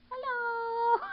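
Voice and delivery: very high, loud mock kitten greeting; squeaky kitten voice. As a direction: speak for the kitten, tiny and squeaky. Voicing kitten's hello.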